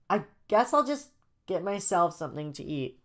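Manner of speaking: high put-on kid voice; sighing kid. Joey giving up on Jaden, sighing kid voice. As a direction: give in, as a voiced child. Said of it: "Joey sighs and says".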